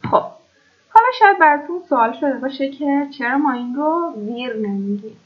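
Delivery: ordinary talk, nothing marked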